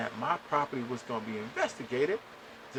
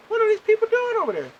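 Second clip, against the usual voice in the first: much higher, louder and fast, a real angry outcry; fired-up outburst; 8 dB louder, faster than usual. Real, not acted. Ranting to his viewers about the energy company, shouting angrily. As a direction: let it burst out in exasperation. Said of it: "I'm fired up".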